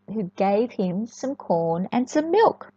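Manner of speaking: ordinary delivery, nothing marked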